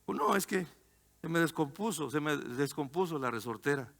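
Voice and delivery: softly retold upset child; almost crying. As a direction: imitate a dejected little boy, understated. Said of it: "casi llorando".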